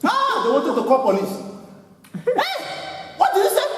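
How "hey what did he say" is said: high, startled "what did he say?"; a shocked church member cries out in alarm; alarmed outcry. Retold or imitated. Preacher mimics the startled congregation, high alarmed outcry. Australians shocked at his words.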